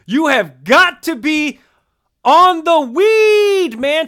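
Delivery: loud, high, slow sportscaster bellow; hammed-up outraged rant, stretching the words; Stephen A Smith. Star indignant about the game ball, hammed-up sports-pundit impression. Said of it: "to channel our Stephen A Smith".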